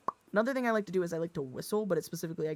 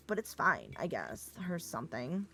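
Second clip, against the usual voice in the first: ordinary talk, nothing marked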